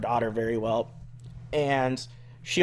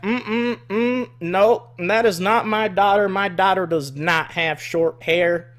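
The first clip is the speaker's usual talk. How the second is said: higher, huffy Southern denial; 5 semitones higher, 4 dB louder, slower than usual. Retold or imitated. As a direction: shake it off like a stubborn country mom. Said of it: "redneck woman".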